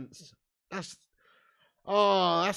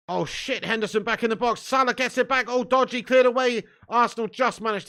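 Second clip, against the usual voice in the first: rising louder and higher as the danger builds; nervy fan shouting at a goalmouth scramble; tense live commentary; 8 semitones higher, 8 dB louder than usual. Real, not acted. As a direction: call the scramble in the box like it's happening now. Live near-miss in own box.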